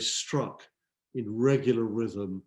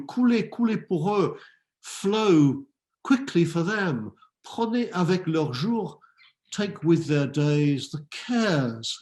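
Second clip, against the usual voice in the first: raised, declaimed poetry with lingering phrases; impassioned poem recitation; 3 semitones higher, 4 dB louder than usual. Acted. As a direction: plead in verse, French then English. Recites the beloved's plea to time.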